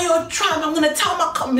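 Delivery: high, heated acted-out threat; re-enacted high-pitched tirade; angry threat. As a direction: play the cousin hurling insults at the door. Cousin threatens him, acted out.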